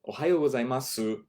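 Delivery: gozaimasu with a full final su; foreign-sounding. Shown as a demonstration. Teacher shows how learners sound foreign. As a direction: pronounce every sound of gozaimasu. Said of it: "you will sound foreign".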